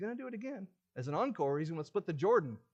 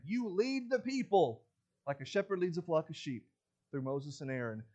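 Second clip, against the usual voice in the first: usual speaking voice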